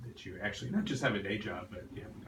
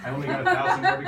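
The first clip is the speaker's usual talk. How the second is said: much higher and louder, laughing through it; laughing joke; 11 dB louder than usual. Real, not acted. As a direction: squeal the joke while laughing. Joking about the long book.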